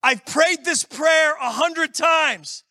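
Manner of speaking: lightly voiced frustrated prayer; exasperated prayer. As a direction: complain to God, worn out from waiting. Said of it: "where I'm like".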